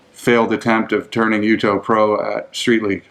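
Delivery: usual speaking voice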